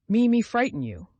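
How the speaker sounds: high, loud, hurt disbelief; indignant cry. A narrator's reading. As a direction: fling the question back at her, loud. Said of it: "cried Mitya".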